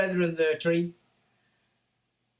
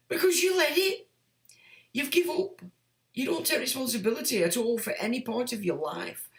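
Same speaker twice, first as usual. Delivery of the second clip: usual speaking voice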